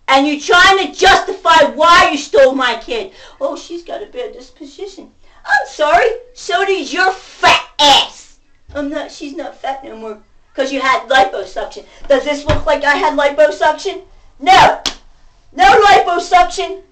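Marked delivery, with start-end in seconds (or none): none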